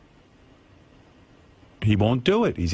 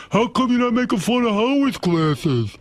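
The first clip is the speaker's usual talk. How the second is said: higher, mock-whiny heckler imitating Ronnie; 9 semitones higher than usual. Howard mimics his guard heckling people, a mocking high whine. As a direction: parody the guard needling people.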